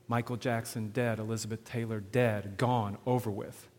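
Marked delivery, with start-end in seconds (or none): none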